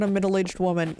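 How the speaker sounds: normal talking voice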